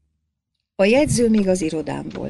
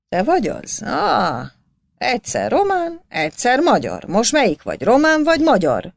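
loud, high, scornful taunting; mocking sneer; 7 semitones higher, 5 dB louder than usual. A narrator's reading. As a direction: jeer at him from the desk. Clerk mocks the peasant. (gúnyos, hangos csúfolódás)

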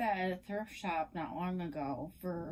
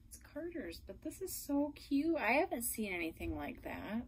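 ordinary talk

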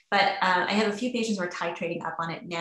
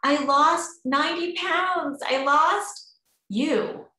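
thrilled, drawn-out report of a big weight loss, pitched much higher; excited patient; 6 semitones higher, 3 dB louder, slower than usual. Retold or imitated. Patient excitedly tells the doctor about her weight loss, high and slow. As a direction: gush it like a delighted patient. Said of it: "She was like".

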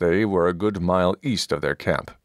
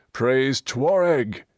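slow, higher battle cry; rallying cry; 7 semitones higher, slower than usual. Acted. Dwarf rallying his comrades to praise their god, slow cry. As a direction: call it out to the god. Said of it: "echoing Coin's cry".

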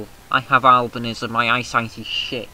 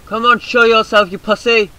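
high, loud taunt at the enemy; real jeering call at hidden tank; taunting shout; 9 semitones higher, 10 dB louder than usual. Real, not acted. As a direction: goad him out of hiding.

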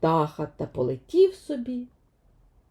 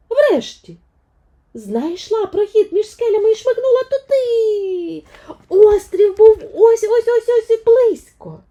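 excited narration; 14 semitones higher, 7 dB louder than usual. A narrator's reading. Narrator builds tense climax.

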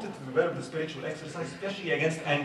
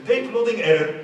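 louder, higher, like a machine announcing failure; mock error message; 5 semitones higher, 12 dB louder than usual. Imitating an old computer's error message, loud. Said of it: "it tells you".

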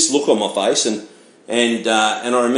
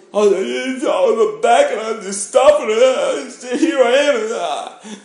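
much higher, slow sobbing mimicry; mock blubbering; 11 semitones higher, much slower than usual. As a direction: imitate yourself crying too hard to get words out. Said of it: "I started crying like a baby".

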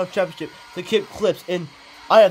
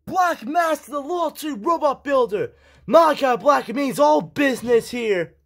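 excited shout; 9 semitones higher, slower than usual. Real, not acted. Commentator losing it at a surprise entrance, shouting high. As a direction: scream it like you can't believe who's coming out.

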